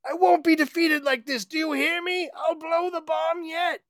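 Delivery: very high villain rant. Acted. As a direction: rave like a cornered supervillain. Lex ranting villainously.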